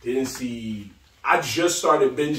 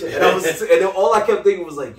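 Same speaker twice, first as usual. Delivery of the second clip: usual speaking voice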